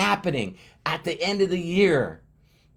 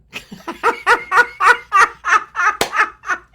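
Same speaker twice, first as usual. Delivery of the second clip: a sudden, high, loud laugh; real laugh breaking out; high burst of laughter; 6 dB louder than usual. Real, not acted. Laughing at himself while telling viewers about YouTube, high laugh.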